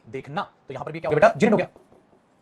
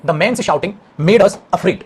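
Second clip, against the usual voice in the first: slow dictation; much slower than usual. Shown as a demonstration. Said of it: "dictating the sentence again".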